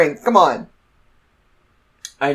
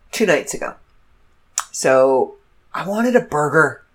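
usual speaking voice

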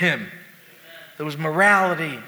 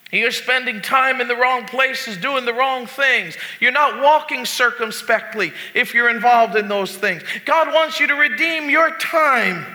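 high, urgent pulpit voice; forceful preaching; 6 semitones higher, 4 dB louder than usual. Acted. Pastor warning the congregation about worldly entertainment, forceful preaching. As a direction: preach it hard, punching key words.

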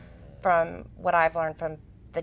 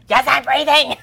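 loud, higher, fed-up daughter voice; exasperated teen reply; 5 semitones higher, 11 dB louder than usual. Retold or imitated. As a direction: snap back like an annoyed teenager. Daughter quoted, annoyed.

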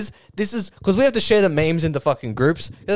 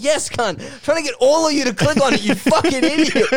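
higher-pitched, mock-furious comeback; exasperated retort; 6 semitones higher than usual. Poster answering an angry commenter, comically exasperated. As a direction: hit back in comic exasperation.

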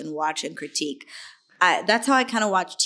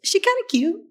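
playful surprise; 13 semitones higher, 4 dB louder than usual. Retold or imitated. Rewatching herself, pleasantly surprised.

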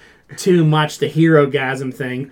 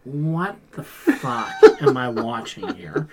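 stunned disbelief; slower than usual. Retold or imitated. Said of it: "I'm just like".

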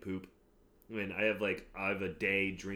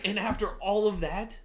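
excited; 12 semitones higher, 7 dB louder than usual. Real, not acted. Building up drinking story.

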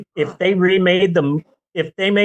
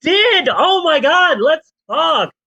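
high, loud burst of delight; suddenly thrilled, voice shooting up; excited; 13 semitones higher, 3 dB louder than usual. Real, not acted. Hears co-host saw the film.

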